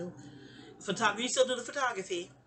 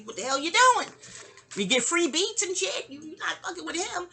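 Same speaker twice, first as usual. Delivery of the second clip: high, indignant telling-off; acted indignant defense; 8 semitones higher than usual. Rappers defending their producer, mock indignant. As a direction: act out a pitched-up protest.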